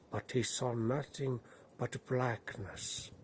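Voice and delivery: plain reading voice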